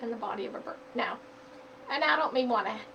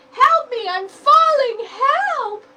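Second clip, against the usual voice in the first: high, loud cries for help while falling; panicked yell; 12 semitones higher, 16 dB louder, much slower than usual. Acted. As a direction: shout for help mid-tumble. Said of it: "yelled the small bird".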